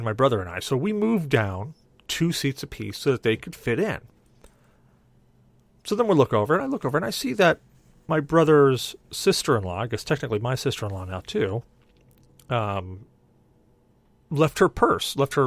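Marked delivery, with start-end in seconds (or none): none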